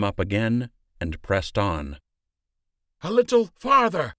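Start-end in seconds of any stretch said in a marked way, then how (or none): 3.0-4.1 high, loud encouraging call. A narrator's reading. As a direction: call ahead to urge them on. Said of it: "Lander called".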